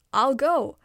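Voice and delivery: bold loud declaration. A narrator's reading. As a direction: call it out proudly. Turtle volunteers bravely.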